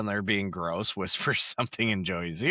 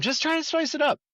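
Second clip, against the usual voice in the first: high, quick Monica; 13 semitones higher, faster than usual. Retold or imitated. Monica defending herself.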